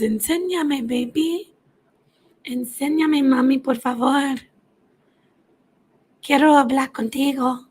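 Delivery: put-on high, cooing baby voice pleading in Spanish. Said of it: "a baby voice".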